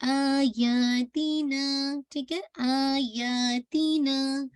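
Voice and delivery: आयातीना very slow, first आ stretched; Quran recitation demo. Shown as a demonstration. Teacher to student, slow stretched आयातीना. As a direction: draw out the आ of आयातीना. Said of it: "मद्दुल बदल कहलाती है".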